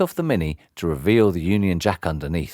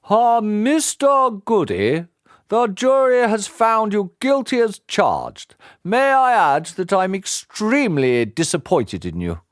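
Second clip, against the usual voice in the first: put-on stern old judge; 12 semitones higher than usual. Old judge scolding the guilty father, stern and high. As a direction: scold from the bench. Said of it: "continued Judge Pillar".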